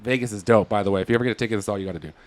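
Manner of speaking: normal talking voice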